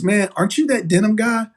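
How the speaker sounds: lightly voiced excited bartender; surprised recognition. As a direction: bartender spotting the denim guy, surprised. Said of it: "the bartender looked at me and goes".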